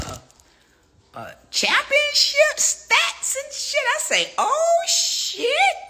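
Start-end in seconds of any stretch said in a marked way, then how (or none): 4.4-5.9 loud, very high exclamation; hammed-up squeal of amazement; retold shock. Seeing championship stats, loud high mock shock.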